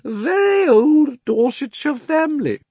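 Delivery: high, drawn-out self-pitying wail. A narrator's reading. Uncle Andrew protesting in the darkness, wailing. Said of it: "it was wailing on".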